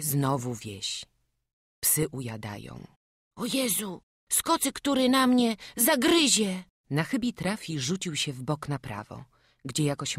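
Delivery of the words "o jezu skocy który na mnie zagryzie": put-on high, alarmed child's voice; frightened boy. Runaway boy fears the village dogs will bite, high frightened cry.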